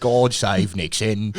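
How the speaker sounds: a mock royal toast in British accent. Soldier toasting Nixon, in slow mock British accent. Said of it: "I don't know why the Americans are British".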